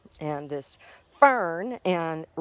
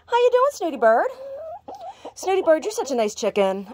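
pet voice; 13 semitones higher, 4 dB louder than usual. Real, not acted. Owner cooing at her hen Snooty Bird, very high baby talk.

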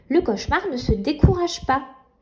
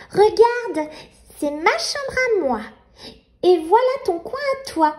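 put-on little girl voice; 5 semitones higher than usual. Nina showing baby brother round.

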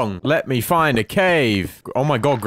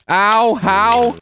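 high, loud yelp of disbelief; loud startled ow; pained outrage; 12 semitones higher, 6 dB louder than usual. Real, not acted. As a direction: yell at the unfair hit. Shot by skeleton.

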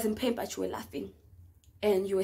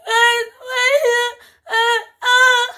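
high, loud wailing; mock crying; 13 semitones higher, 12 dB louder, much slower than usual. Replying to "are you okay", loud mock bawling. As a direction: bawl it out in hammed-up sobs. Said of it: "I'm crying the whole time".

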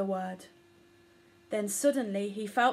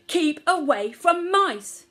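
put-on a mother bellowing to protect her child; furious scream; 5 semitones higher, 7 dB louder than usual. A narrator's reading. Omar threatens the mouse while screaming at her son. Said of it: "screamed Omar".

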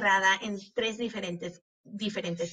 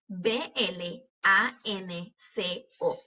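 slow, letter by letter; name each letter separately; spelling out; much slower than usual. Shown as a demonstration. Teacher spelling blanco for pupils, letter by letter. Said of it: "cuando estamos deletreando estas palabras".

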